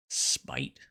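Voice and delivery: usual speaking voice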